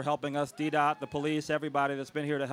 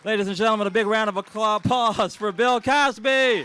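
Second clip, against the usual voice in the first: announcer intro; 6 semitones higher, 9 dB louder than usual. Acted. The host announces the celebrity guest to the crowd in a big MC call. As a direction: sell the big name like a ring announcer.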